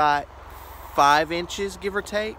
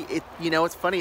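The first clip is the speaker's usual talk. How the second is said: ordinary talk, nothing marked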